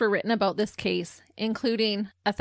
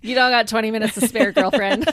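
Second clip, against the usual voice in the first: fast, loud teasing push; playful teasing; 7 dB louder than usual. Real, not acted. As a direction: hurry her along, playfully. Teasing friend to hurry up.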